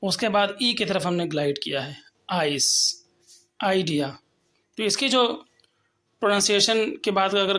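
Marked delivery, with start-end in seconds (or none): 2.3-4.2 आइस, आइडिया said with a drawn-out a and a lower pitch; long glide demo. Shown as a demonstration. Teacher modelling the ai sound, stretched glide. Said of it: "अ sound को हमने खींच करके बोला".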